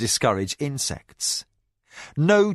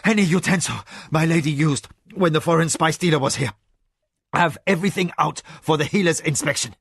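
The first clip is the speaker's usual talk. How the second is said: urgent orders; 4 semitones higher, faster than usual. A narrator's reading. Frantic husband giving urgent orders.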